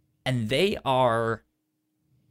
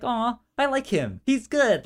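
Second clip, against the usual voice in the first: voiced much higher, a loud mock-sweet coo; fond aw; 12 semitones higher, 4 dB louder than usual. Host voicing fans' fond reaction to the clone, high affectionate coo. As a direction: gush over him like a fan melting at a cute character. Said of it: "like, really just like".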